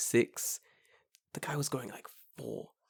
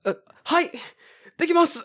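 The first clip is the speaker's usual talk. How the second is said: very high, startled squeaky answer; flustered reply; faster than usual. Retold or imitated. Runner answers the shouting old man, very high flustered. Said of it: "And I'm like".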